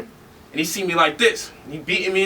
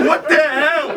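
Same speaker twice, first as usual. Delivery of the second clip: higher and louder, laughing through the words; laughing disbelief; 9 semitones higher, 5 dB louder, slower than usual. Real, not acted. Baffled outburst after laugh.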